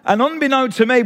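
normal talking voice

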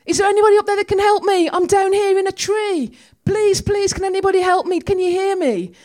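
high, loud calls for help from the tree; a man stuck in a tree calls out for help; shouting for help. Retold or imitated. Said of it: "he suddenly shouts".

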